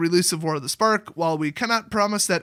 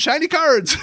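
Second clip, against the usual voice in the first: excited; 8 semitones higher, 5 dB louder, slower than usual. Real, not acted. Gushes over shiny cards.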